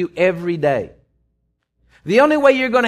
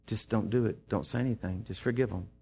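soft, hushed inner voice; barely above a breath, gently urging; still small voice; 8 semitones lower, 13 dB quieter than usual. Retold or imitated. The inner voice urging forgiveness, hushed and soft. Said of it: "that little still small voice".

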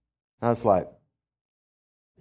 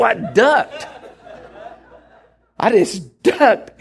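laughing; 9 semitones higher, 4 dB louder, much slower than usual. Real, not acted. Laughing while retelling the duck.

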